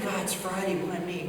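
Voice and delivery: soft, put-upon mock grumble; weary. Controller privately fed up on a Friday, weary. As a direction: grumble it softly to yourself. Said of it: "You could just see him like".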